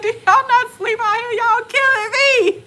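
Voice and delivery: loud, very high mock outrage; exasperated disbelief. Sleepless traveler protesting to companions, exasperated. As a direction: cry out as if they're wearing you out.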